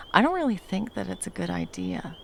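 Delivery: ordinary delivery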